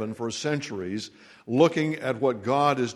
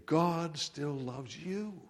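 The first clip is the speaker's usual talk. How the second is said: slow and much softer, almost hushed; tender assurance; 4 semitones higher, 8 dB quieter, much slower than usual. Real, not acted. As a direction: lay it gently on one listener's heart.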